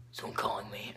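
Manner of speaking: soft, whispery put-on teacher voice; husky, hushed hero impression; Batman. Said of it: "that's just the voice I'm gonna give him".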